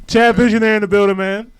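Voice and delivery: loud, excited welcome shout; hyped loud greeting; hype shout. Real, not acted. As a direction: announce him like he just walked in. Hyping up a friend arriving.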